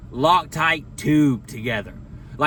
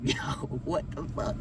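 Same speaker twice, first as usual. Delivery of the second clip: hushed disbelief; 11 dB quieter than usual. Retold or imitated.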